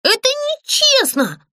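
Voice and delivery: high, slow hurt protest; high protesting puppet. Acted. Puppet protests to the ghost, high and hurt. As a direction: it isn't fair.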